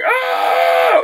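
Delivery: high shouted ah; excited scream. Retold or imitated. Endgame surprise, a high excited scream. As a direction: scream with fans. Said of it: "we were still like".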